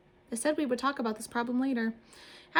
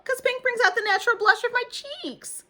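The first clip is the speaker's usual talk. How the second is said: very high, loud preening; prissy girl; 7 dB louder than usual. A narrator's reading. Lucille preening about her pink spots, very high and loud. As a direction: show off your pink. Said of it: "said Lucille".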